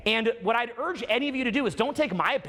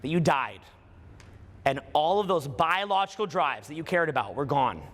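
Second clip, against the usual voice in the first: guided meditation; 4 semitones lower than usual. Acted. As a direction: lead them gently into it.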